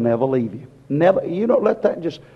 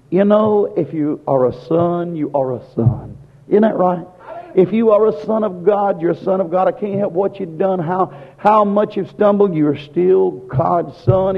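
fervent preaching; 3 semitones higher than usual. Acted. Preacher urging the congregation they remain God's sons, fervent. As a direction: press the point home to the congregation.

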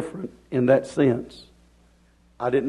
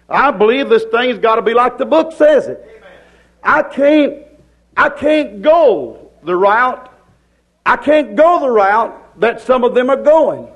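high, driving sermon delivery; full-force preaching; 7 semitones higher, 6 dB louder than usual. Real, not acted. Preacher defending his stand to the folks, full-force preaching.

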